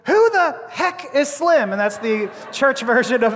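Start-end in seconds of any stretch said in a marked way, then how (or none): 0.0-1.7 high, slow demand from a coach; mock indignation. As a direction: stretch out an indignant question in a high voice. Coach demands an answer, acted out.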